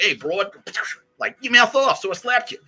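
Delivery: high, loud hammed-up old-movie heavy; fifties tough guy. Mocking coworker's old-film tough guy, hammed up loud. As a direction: bark it like a 1950s movie brute. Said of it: "nineteen fifties character actor".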